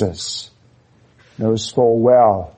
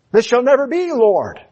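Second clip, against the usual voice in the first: emphatic Peter; 10 semitones higher than usual. Retold or imitated. Peter quoted from Matthew.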